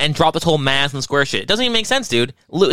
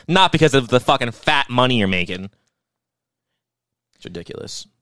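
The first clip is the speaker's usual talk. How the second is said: ordinary talk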